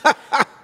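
loud high nervous laugh; burst of laughter. Real, not acted. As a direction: let a sharp laugh escape. Laugh alone on line.

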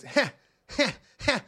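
three separate scornful hehs; spaced-out, hammed-up evil chuckle; mock villain laugh. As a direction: laugh down at a lesser being, one beat at a time. Acts demon's scornful laugh.

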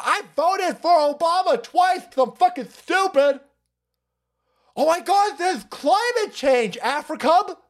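high whiny mocking voice; mock liberal voice. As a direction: squeal it like a shocked liberal.

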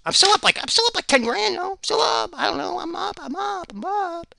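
squeaky caricature of a show-off; mocking a fake-famous guy. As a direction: play the clueless influencer bragging about being up. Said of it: "you're like".

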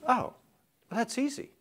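Peter, lightly voiced. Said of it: "and Peter says".

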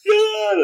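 a very high, loud wild shriek; crazed scream. Shown as a demonstration. Showing the listener his crazed scream in the car. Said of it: "like this".

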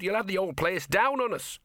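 high, urgent dog character voice; scolding talking dog. A narrator's reading. Dog fearing the roof will fall, high urgent scolding.

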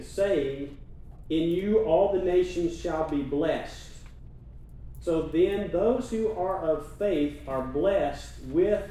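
slow, loud preaching read; scripture reading. Acted. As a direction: proclaim the verse slowly and loud. Reads scripture aloud.

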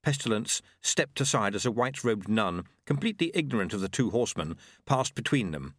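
ordinary delivery, nothing marked

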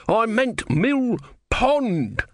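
very high, stretched-out wail; desperate wailed correction. Acted.

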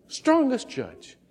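ordinary talk

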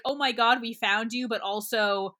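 ordinary delivery

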